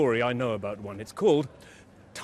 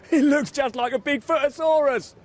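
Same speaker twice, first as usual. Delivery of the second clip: laughing line; 12 semitones higher, 5 dB louder than usual. A narrator's reading. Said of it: "laughed Tiny".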